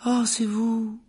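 high-pitched school nurse; fussy nurse. A narrator's reading. Nurse recognising her patient, high and fussy.

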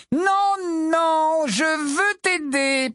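put-on high, slow insistent young witch; trumpeting protest. Tonk insisting on helping Molly, trumpeting. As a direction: insist brightly. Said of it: "d'un ton claironnant".

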